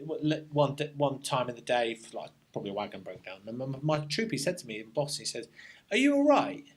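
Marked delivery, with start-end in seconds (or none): 5.9-6.7 much higher, louder imitated question; imitated concerned check-in. Troop boss checking on a bereaved soldier, imitated concern. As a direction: pitch it right up, quoting someone else.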